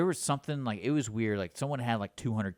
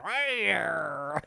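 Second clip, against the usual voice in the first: higher, croaky cat-man greeting; old-timey guy meow; 7 semitones higher than usual. Retold or imitated. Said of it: "That's what his throat sounded like".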